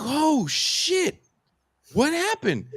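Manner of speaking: high-pitched startled reaction, retold; shocked reaction. Bandleader retells seeing a punch land, high mock shock. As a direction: act out stunned disbelief up high.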